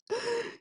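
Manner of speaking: sharp gasp of surprise; shocked gasp. Real, not acted. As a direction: gasp at the sight. Finds hair on herself.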